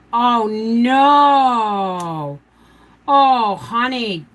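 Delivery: long drawn-out, dismayed "no"; dismayed sympathy. Real, not acted. Hearing of the broken pie filling, dismayed. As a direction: wail it in sympathy, stretching the vowel.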